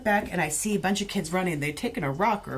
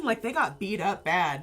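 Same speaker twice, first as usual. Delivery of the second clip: normal talking voice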